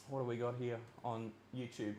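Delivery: very soft, absent-minded mutter; talking to himself. Real, not acted. Streamer checks YouTube for messages, muttering to himself.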